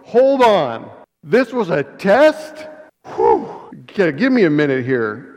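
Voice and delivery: Abraham shaken as the test ends, loud and stunned; shocked relief. Retold or imitated. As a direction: blurt it out, stunned that it was only a test. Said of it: "if I was Abraham, I would go".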